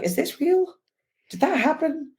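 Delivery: high-pitched, bewildered questioning; mock-bewildered, pitched well up; acted disbelief. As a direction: wonder aloud as if doubting it happened. Acted disbelief at a surreal moment.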